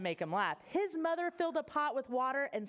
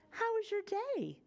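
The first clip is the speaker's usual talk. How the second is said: higher, sing-song mum asking about the day; bright mother voice; 10 semitones higher than usual. Acted. Mother greets sulky Horace, high and cheerful. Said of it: "His mother said".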